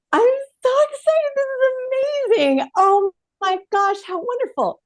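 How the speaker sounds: high, bubbly, thrilled squeal; gushing excitement. Retold or imitated. Thrilled nanny gushing to the husband about the set, high and bubbly. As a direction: gush like a starstruck fan. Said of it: "my reaction was".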